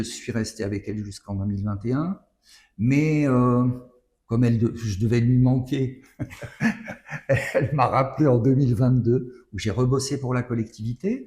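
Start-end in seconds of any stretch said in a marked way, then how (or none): 6.2-7.5 short laugh. Real, not acted. Laughs at his own remark.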